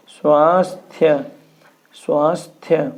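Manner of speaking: स्वास्थ्य slowed down, the final थ्य sounded out; careful pronunciation. Shown as a demonstration. Teacher sounding out the word slowly for students. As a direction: say स्वास्थ्य slowly so every letter is heard. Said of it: "स्वास्थ्य लिखाता है".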